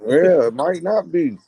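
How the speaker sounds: talking around a wad of tobacco; words through a tobacco-filled mouth; chewing tobacco. Real, not acted. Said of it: "I got tobacco in my mouth right now".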